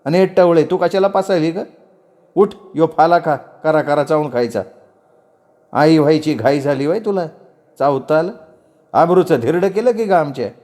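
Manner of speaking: high-pitched scolding, volume held in; aunt's angry yelling. Acted. Aunt orders the young man out and rounds on the girl, angrily. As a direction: scold sharply as an outraged aunt. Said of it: "चाची ओरडली".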